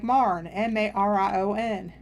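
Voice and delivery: "Marne" in one syllable, then slow spelling M A R I O N; local dialect pronunciation. Retold or imitated. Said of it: "And they're like".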